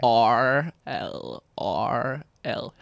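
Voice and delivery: slow deliberate R L R L; warm-up. Real, not acted. Guest prepping for an American accent, slow drill. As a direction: step through it slowly as a warm-up drill. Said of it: "it's a technique I use".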